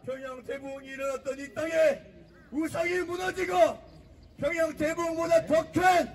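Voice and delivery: shouted rally chants; roaring rallying speech. Real, not acted. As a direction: fire up the crowd, full force. Rally speech with call and response. (포효하는 집회 연설)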